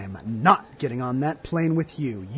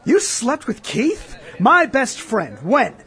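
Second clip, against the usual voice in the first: high, shocked disbelief; 10 semitones higher, 4 dB louder, slower than usual. Acted. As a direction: reel from the news, voice climbing. Shocked at betrayal.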